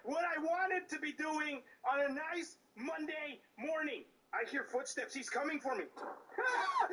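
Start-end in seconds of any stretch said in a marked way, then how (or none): 6.0-6.9 very high, sudden shriek of fright; startled scream. Real, not acted. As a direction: scream as if jumped by a monster. Startled by the game.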